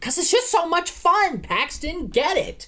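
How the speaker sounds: very high, overacted actress voice; Anne Hathaway impression. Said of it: "this whole movie, she's like".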